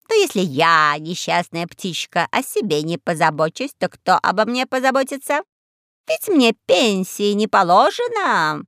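whiny self-pitying crow; raspy cawing crow. Acted. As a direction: play the poor little bird, slowly.

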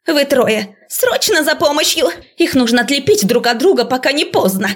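высокий тревожный окрик; командуй как при бедствии; срочный приказ. A narrator's reading. Преподавательница срочно зовёт помощь. (панически, повышенным голосом)

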